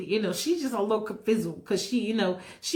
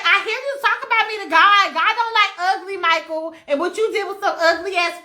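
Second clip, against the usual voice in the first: high, loud, brassy demanding; sassy woman voice pestering; demanding diva; 5 semitones higher, 4 dB louder than usual. Acted. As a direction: press him, loud and impatient. She scolds Michael.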